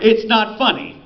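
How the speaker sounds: sharp high protest; indignant outburst. Retold or imitated. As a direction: blurt it out, offended. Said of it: "I was like".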